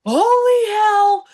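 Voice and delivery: high, loud and stretched-out "Holy hell"; big sister shocked by a fat squirrel, loud and high; stunned exclamation. Retold or imitated. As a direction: gasp it out in disbelief at a huge squirrel. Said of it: "She goes".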